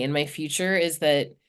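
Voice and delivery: normal talking voice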